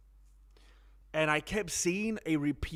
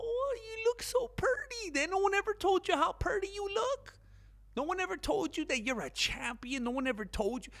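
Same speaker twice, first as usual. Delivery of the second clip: high mock sweet-talk flattery; sweet-talking Eddie Hearn. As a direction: coo at him like a sweet-talker. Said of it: "that's how Eddie Hearn's talking to him".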